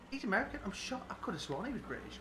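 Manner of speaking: mock-surprised, pitched high; retold surprise. Viewer finds out an actor is American, retold surprise. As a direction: retell a startled thought, voice jumping up. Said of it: "you think".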